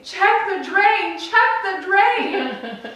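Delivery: high, loud, drawn-out demand; irate child. Retold or imitated. Jill to sibling in the bathroom, irate yell. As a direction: imitate a furious kid yelling. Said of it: "Jill is just irate".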